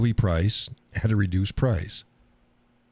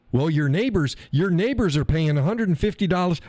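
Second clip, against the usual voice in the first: mock salesman; 9 semitones higher than usual. Pushy salesman quoted.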